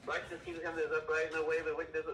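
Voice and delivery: gabbling the verse far too fast; rushed reading. Shown as a demonstration. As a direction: race through it like a careless reader. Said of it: "Nobody wants to hear how fast you can read".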